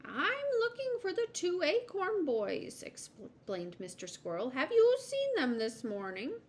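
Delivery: high-pitched squirrel explaining; high storybook squirrel; polite squirrel. Acted. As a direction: explain your errand in a high voice. Voices Mister Squirrel explaining.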